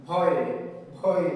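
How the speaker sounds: ভয় said with a clear ɔɪ glide, not বয়; corrected pronunciation. Shown as a demonstration. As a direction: say ভয় with a round ɔɪ. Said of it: "আসলে বয় না". (সংশোধিত উচ্চারণ ভয়)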